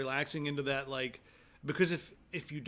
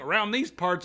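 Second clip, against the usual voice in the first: loud, high, hokey Southern twang; fake Southern accent; 6 semitones higher, 7 dB louder than usual. Friend answering suspicious driver, fake Southern accent. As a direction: badly fake being a local Southerner. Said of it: "Like, this voice".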